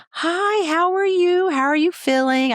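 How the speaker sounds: high, chirpy greeting, pitch well up; sweet nurse voice. Retold or imitated. Student nurse greeting her patient, high chirpy sweetness. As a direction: sing it out like an eager student nurse.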